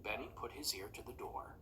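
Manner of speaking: plain reading voice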